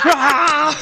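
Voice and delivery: loud, high metal roar. Pretend. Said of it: "I'm gonna blast, uh, Slipknot down the mic".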